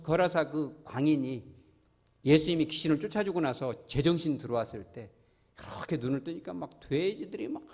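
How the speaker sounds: ordinary talk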